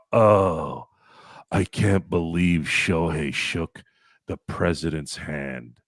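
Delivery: slow, sneering complainer voice; mocking whiner. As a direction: drag it out like a whiny critic on a soapbox. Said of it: "start yapping about".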